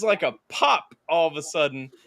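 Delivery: usual speaking voice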